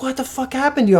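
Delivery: high-pitched mock alarm; retold shocked question; alarmed friend. Friend alarmed at injured teller.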